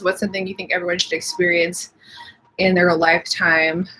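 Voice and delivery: normal talking voice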